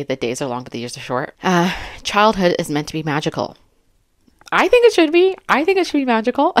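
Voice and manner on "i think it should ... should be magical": high, airy mock voice; lilting high-pitched aside. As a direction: squeak it out playfully. Mock sing-song aside on magical childhood.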